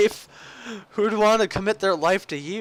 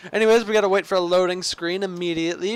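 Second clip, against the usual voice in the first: ordinary talk, nothing marked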